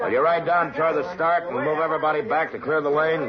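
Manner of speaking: calling out. Acted. Marshal calling out asking the rider to clear the lane. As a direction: project it to a rider down the track.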